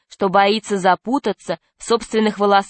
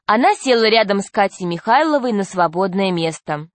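ordinary delivery, nothing marked

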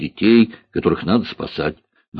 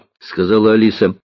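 ordinary delivery

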